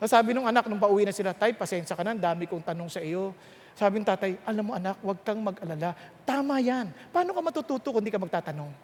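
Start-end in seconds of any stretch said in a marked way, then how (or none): 1.3-3.3 soft, low, lightly voiced sorry kid; a hint of a child saying sorry quietly; apologetic son. Son apologizing to his dad on the way home, soft and light. 4.5-8.7 soft, low, quick gentle dad; lightly voiced comforting father; reassuring father. Father reassuring his son it's fine to ask, soft and gentle.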